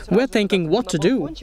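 usual speaking voice

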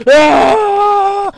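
high cry of frustration; pained shout. Real, not acted. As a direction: yelp out loud. Losing, cries out.

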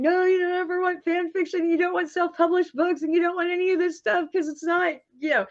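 high-pitched professor voice in the head; scolding writing professor. Retold or imitated. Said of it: "my writing professor from college in my head".

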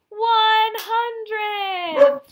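high, loud triumphant count ending; cheering at the end of the count; triumphant finish. Acted. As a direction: land the last number big.

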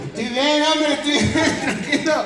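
laughing, insisting he's fine; laughing reassurance. Retold or imitated. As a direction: wave off the fuss with a laugh. Paciente calma al doctor. (tranquilizando entre risas)

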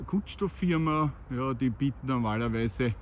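usual speaking voice

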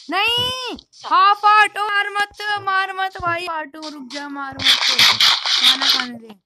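high, loud desperate begging; panicked pleading. Real, not acted. Gamer on half a heart begging not to be killed, panicked. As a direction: beg the mob not to hit you.

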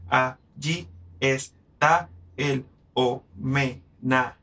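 "Allí está el homenajeado" spelled out slowly with each vowel in its own syllable; overly careful slow "Allí está el homenajeado"; slow, every syllable apart. Shown as a demonstration. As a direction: say "Allí está el homenajeado" slowly with no vowels joined, to show it runs too long. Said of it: "si yo lo digo bien, no me cae".

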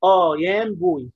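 آه يام بوي read slowly with a long open آه, just as spelled; slow deliberate misreading of آه يام بوي; teacher demonstrating a common mistake; wrong pronunciation. Shown as a demonstration. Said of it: "ودي قراءة خطأ".

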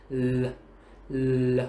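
đọc "L" như chữ L tiếng Việt, lưỡi chạm lợi; phát âm mẫu "L" thật rõ, chậm rãi. Shown as a demonstration. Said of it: "giống chữ L trong tiếng Việt". (đọc mẫu âm "L" như L tiếng Việt)

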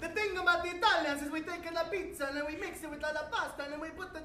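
put-on Italian accent. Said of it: "He's Italian".

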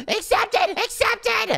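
high squealed accepted twice; squeaky excited verdict. Acted. Nico accepts the horse girl in a high squeal. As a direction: squeal it out as the judge.

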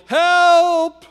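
high, drawn-out cry of help; calling for help. A narrator's reading. Trapped missionary calls as the ants approach, calling for help. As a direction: call out long and high, far above your voice. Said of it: "and he called".

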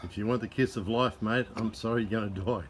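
ordinary talk, nothing marked

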